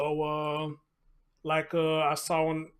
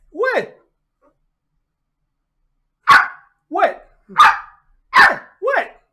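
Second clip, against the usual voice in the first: much higher and louder playful what; high calls to puppy; 15 dB louder, much slower than usual. Real, not acted. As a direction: answer his barks with a squeaky what. Answering barking puppy.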